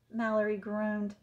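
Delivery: usual speaking voice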